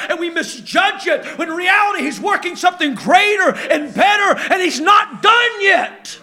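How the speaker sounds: impassioned sermon climax; fervent preaching. Acted. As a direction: preach it hard, building to the close. Preaching at full force.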